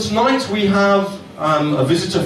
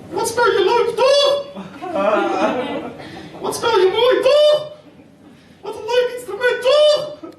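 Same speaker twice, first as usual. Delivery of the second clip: mock Dutch girls, much higher throughout; high squeaky impression of Dutch girls calling him dog; slower than usual. Comic imitating Dutch girls at his harp, high mock voice. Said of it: "that lovely Dutch girls coming up to you going".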